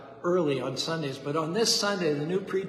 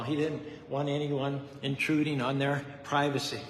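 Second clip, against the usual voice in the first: ordinary talk, nothing marked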